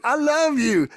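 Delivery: put-on high, fond baby-talk to a bear; sweet talk to a bear. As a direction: tell the bear you love it in a high coo. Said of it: "And I'm like".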